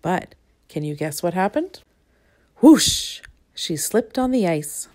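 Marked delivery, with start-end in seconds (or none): 2.6-3.2 high, loud sliding whoosh; narrator's sliding-on-ice whoosh; whoosh sound. A narrator's reading. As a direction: make the slip sound. She slips on the ice.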